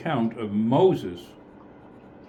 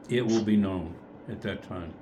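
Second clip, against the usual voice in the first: usual speaking voice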